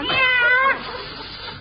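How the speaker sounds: long, high cat yowl, falling away; stretched-out meow. Acted. Teacher covering for a hidden cat, long stretched-out meow. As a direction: draw the meow right out like a cat. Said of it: "stretch it out with a little western drawl".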